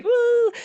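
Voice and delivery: woo sound. Real, not acted. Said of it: "I had a little bit of".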